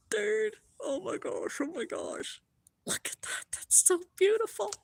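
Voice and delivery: high, breath-caught amazement; real overwhelmed wonder, voice thin and high; moved near tears. Real, not acted. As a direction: gasp it out in hushed awe. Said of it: "I'm gonna cry again".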